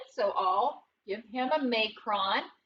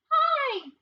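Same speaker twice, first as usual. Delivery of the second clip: squeaky character hello; 14 semitones higher, 5 dB louder than usual. Pretend. Sneaky E greets, squeaky.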